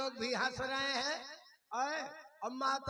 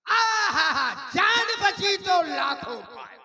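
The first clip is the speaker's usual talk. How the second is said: high, loud whooping proclamation; triumphant shouting after a lucky escape; jubilant relief; 6 semitones higher, 14 dB louder than usual. Acted. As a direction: belt it out, overjoyed to be alive. Celebrating being let off alive.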